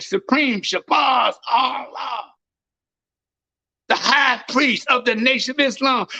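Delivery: high, shouted street sermon; preaching at full force. Acted. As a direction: thunder at the crowd from the pulpit. Preaching to the crowd.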